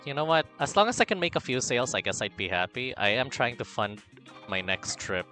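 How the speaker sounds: usual speaking voice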